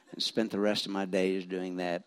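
normal talking voice

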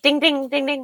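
high sing-song ding imitation; bright mimicked bell dings; pinball bonus dings. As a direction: chime it out like a pinball bonus count. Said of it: "and it's like".

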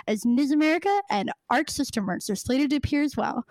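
ordinary talk

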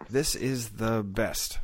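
slow, word-by-word recital; staccato. Retold or imitated. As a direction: chop it into memorized pieces. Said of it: "I would memorize it word, one word at a time".

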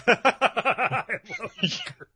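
high, laughing through the line. Retold or imitated. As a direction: squeal it while cracking up. Echoing the joke, laughing.

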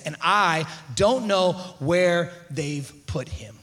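low, slow, word by word; slow, subdued quote. Acted. Preacher quoting Mary at the empty tomb, slow and subdued. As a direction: spell out her loss slowly and softly. Reads Mary's answer from scripture.

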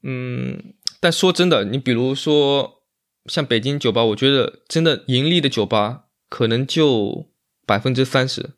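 ordinary delivery

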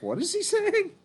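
high, loud, through laughter; laughing bewilderment. Retold or imitated. Podcaster recalls not understanding a line, laughing high. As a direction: squeal the question while cracking up. Laughing over their own confusion.

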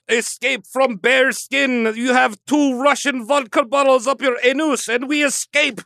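put-on joking Russian accent voice; mock Russian accent. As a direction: ham up a Russian showman accent. Russian voice after Trotsky joke.

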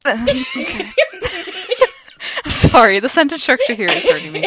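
high-pitched helpless laughter through words; real laughing. Real, not acted. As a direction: apologize while cracking up. Cracks up at bad sentence.

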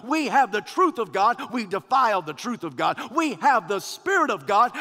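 preaching. Real, not acted. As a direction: thunder it at the congregation. Preaching at full force.

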